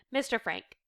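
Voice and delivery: plain reading voice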